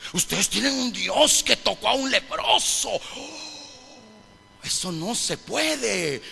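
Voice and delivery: appalled skeptics, building in pitch; scandalized objectors. Acted. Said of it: "ellos, ellos decían".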